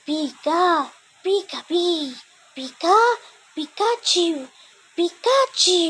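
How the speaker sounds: squeaky little creature calling its name; Pikachu voice. Acted. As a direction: chirp it like a tiny electric mouse. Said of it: "the voice of Pikachu".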